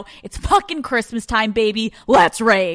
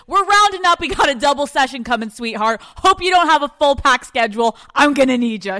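mock dramatic demand; 5 semitones higher, 5 dB louder than usual. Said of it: "I say".

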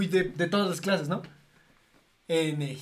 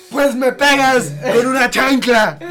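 loud, high, slow scolding mom; mock angry mom; 8 semitones higher, 14 dB louder, slower than usual. Mom threatening the kid with a sandal, shouting. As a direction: bark it like a fed-up mother. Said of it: "la frase clásica es".

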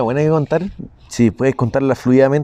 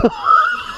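high shrieking laugh. Real, not acted. Laughing at near-death joke.

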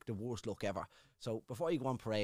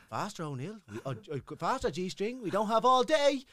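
impatient sergeant; 9 semitones higher, 6 dB louder than usual. Retold or imitated. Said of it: "sergeant drilling the stripper".

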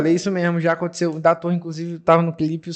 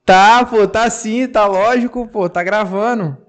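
raised phone voice; falando alto ao telefone; 5 semitones higher, 5 dB louder, slower than usual. Real, not acted. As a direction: reassure someone loudly over a call. Responde ao amigo no telefone. (voz alta de telefonema)

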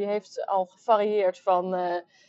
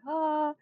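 sneeze build-up gasp; 8 semitones higher, 4 dB quieter than usual. Real, not acted. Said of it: "oh, ik moet niezen".

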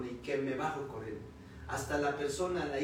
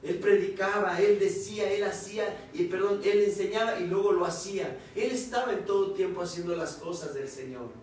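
raised, emphatic sermon delivery; preaching; 4 semitones higher, 6 dB louder than usual. Real, not acted. Preacher testifying to the congregation about obeying God, emphatic preaching.

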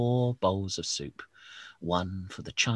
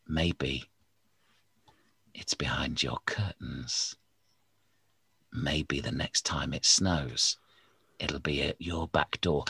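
low, unvoiced spooky whisper; spooky hush; 6 semitones lower than usual. A narrator's reading. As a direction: hush it like a ghost story.

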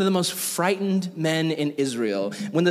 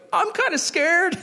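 much higher, small sheepish voice; timid soldier voice. Retold or imitated. Twenty thousand soldiers admit fear in a high sheepish squeak. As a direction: squeak it out like a nervous kid.

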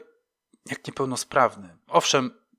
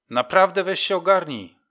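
loud, higher-pitched telling-off; shouting; 7 semitones higher, 6 dB louder than usual. A narrator's reading. Sergeant calling after the departing homeless man, shouting. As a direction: shout it at his back. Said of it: "krzyknął".

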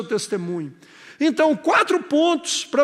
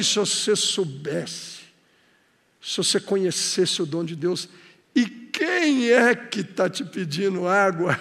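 tender Jesus; 6 semitones lower, 4 dB quieter than usual. Acted. Jesus gently speaking to the woman at the well, tender and soft.

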